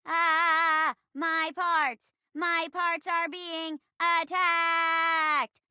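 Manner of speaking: high, slow panicked wail; robot crying out. Acted. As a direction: cry out as your parts break.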